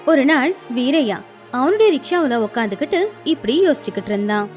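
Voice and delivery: ordinary delivery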